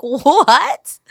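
loud, high startled grandma what; shocked what. Retold or imitated. Grandma hears granddaughter is pregnant, loud shocked outburst. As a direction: blurt it out in disbelief.

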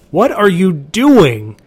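high, loud, slow whining creature demand; demanding whine. A narrator's reading. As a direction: protest like a spoiled monster. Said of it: "it demanded to know".

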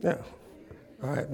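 ordinary talk, nothing marked

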